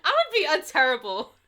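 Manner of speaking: loud, high self-mockery; giggly, loud admission; amused outburst. Real, not acted. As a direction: exclaim it on the edge of laughter. Laughing self-mockery after botched read.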